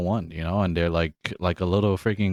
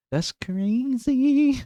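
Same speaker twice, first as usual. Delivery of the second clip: amazed; much slower than usual. Real, not acted. Amazed at cat-lady story.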